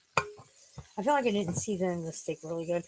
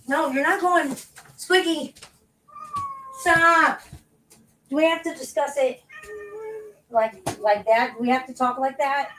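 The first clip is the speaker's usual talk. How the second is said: louder, higher exasperated telling-off; scolding the cat; 7 semitones higher, 6 dB louder than usual. Real, not acted. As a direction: put your foot down with the cat.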